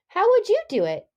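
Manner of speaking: put-on asked high and loud to the kids; eager question. Teacher asking the class, eager and lively. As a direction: throw the question out to the children.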